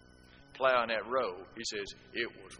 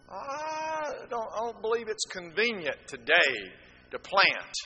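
excuse-making farmer; 8 semitones higher, 3 dB louder, slower than usual. Acted. Lazy farmer's excuse voiced.

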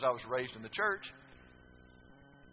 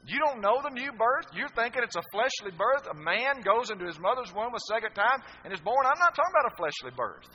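high, loud incredulous rebuke; Jesus chiding a teacher of Israel; Jesus rebuking Nicodemus; 8 semitones higher, 9 dB louder than usual. Acted. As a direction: scold the learned teacher, rising. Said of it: "Jesus said that to Nicodemus".

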